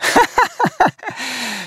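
soft guilty little laugh; guilty chuckle. Real, not acted. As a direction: chuckle you're trying to hold back. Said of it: "I shouldn't be laughing at that".

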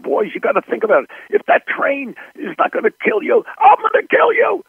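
put-on director yelling threats at child actors, building to a shriek; screaming at the boys. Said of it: "I started screaming at them like this".

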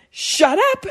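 high-pitched imitated yell; mock yell. Mom yelling at her son, high-pitched mock yell.

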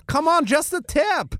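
high, drawn-out camp coaxing. Retold or imitated. Bruno coaxing the wrestlers, slow high wheedling. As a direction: slowly wheedle in a high Austrian voice.

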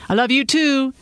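husband echoing wife's goodbye, singsong; singsong reply. Acted. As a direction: sing it back like a chorus. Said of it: "he harmonized back".

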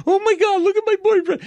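very high, loud hysterical wail; mock crying girlfriend. Comic voicing girlfriend over bleeding boyfriend, mock crying. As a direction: imitate a girlfriend shrieking through tears. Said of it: "his girlfriend starts crying".

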